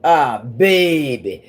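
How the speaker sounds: put-on slow, stretched Southern drawl on baby. Imitating New Orleans folks to livestream viewers, slow Southern drawl. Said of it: "real Southern drawl".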